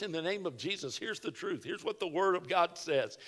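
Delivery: soft, low-key statement of truth; hushed, matter-of-fact declaration; quiet, no yelling. Shown as a demonstration. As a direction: say it quietly, without any force. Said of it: "It's not on how loud you can yell".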